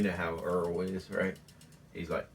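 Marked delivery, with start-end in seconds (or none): none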